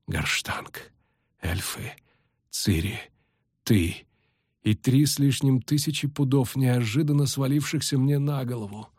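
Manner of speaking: weak voice, halting from word to word; drained, faltering recollection; exhausted sorceress, remembering. A narrator's reading. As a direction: piece the memory together wearily. Exhausted sorceress piecing memory together.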